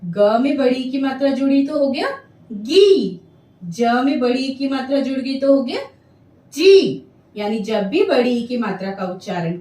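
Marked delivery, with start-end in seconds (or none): 2.5-3.2 गी with a clearly stretched long ii; drawn-out long ii. Shown as a demonstration. Teacher showing pupils गी with a long ii. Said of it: "एक बार फिर से उच्चारण करते हैं". 6.5-7.0 जी with a long stretched ii, a bit louder; drawn-out long ii. Shown as a demonstration. Teacher showing pupils जी with a long ii. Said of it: "एक बार फिर से उच्चारण करते हैं".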